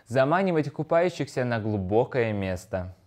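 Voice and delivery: normal talking voice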